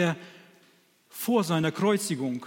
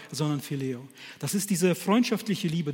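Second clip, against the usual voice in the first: ordinary talk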